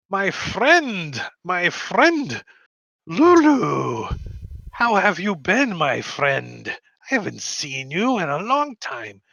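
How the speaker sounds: put-on high, loud greeting in an Indian accent; effusive old-friend welcome, Indian accent. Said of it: "and then he's like".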